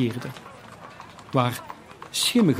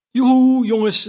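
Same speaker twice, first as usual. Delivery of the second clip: high, loud hearty call; jovial full voice; 10 semitones higher, 7 dB louder than usual. A narrator's reading. Old master calling his apprentices, jovial and loud. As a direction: boom out a cheerful greeting to the apprentices. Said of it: "zei Fezziwig".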